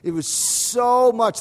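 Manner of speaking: "so" drawn out long and high; stress on "so". Shown as a demonstration. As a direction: stretch "so" to show sheer amount. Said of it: "we tend to emphasize the word so".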